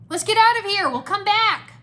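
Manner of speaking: high, loud retreat call; urgent shout. A narrator's reading. Kai calls a retreat, urgent shout. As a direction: shout to pull everyone out. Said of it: "Kai shouted".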